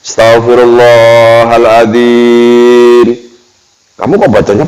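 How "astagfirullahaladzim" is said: astagfirullahaladzim drawn out very slowly, syllable by syllable; slow, lingering istighfar; slow recitation. Shown as a demonstration. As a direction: let astagfirullahaladzim linger as long as a breath.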